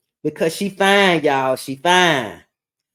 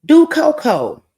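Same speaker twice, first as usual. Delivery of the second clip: high, louder call of Do Coco, stretched slowly; called-out quote; 11 semitones higher, 5 dB louder than usual. Retold or imitated. Someone at the party calling out Do Coco, slow and loud. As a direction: sing out Do Coco like a party shout. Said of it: "somebody said".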